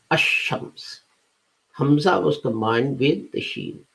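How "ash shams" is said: "ash-shams" with a doubled sh and no L; L dropped. Shown as a demonstration. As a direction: say "ash-shams", sliding from the first a straight into sh. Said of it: "we dropped the al".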